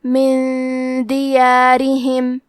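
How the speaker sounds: من دیارہم with the ن hidden into a nasal hum before د, slow; من دیارہم slowly, ن nasalised and hidden; ikhfa with ghunna. Shown as a demonstration. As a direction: hum the ن of من دیارہم through the nose, don't close it. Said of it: "نون ساکن کو چھپاتے ہوئے اور غنہ کے ساتھ".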